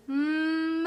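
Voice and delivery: drawn-out low moo; cow moo. Shown as a demonstration. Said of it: "What does a cow say".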